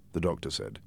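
plain reading voice

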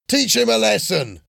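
high-pitched yell of encouragement; shouting. A narrator's reading. Another boy urges Willie on, shouting. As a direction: egg a fighter on with a high yell. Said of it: "shouted another".

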